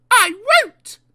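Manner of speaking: high, very loud protest; defiant refusal. Acted. Daisy refusing her driver over the van, defiant. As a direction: refuse point-blank at a high pitch.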